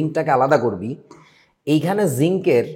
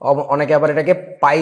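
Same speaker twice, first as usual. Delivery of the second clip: ordinary delivery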